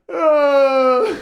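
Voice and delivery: high, loud mock wail of dismayed kids; long hammed-up wail; sad wail. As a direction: imitate children crying out in dismay. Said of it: "They suddenly became really sad".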